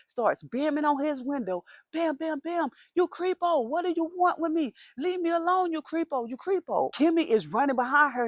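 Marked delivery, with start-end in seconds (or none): 1.9-6.9 mock angry yelling. Banging on neighbor's window.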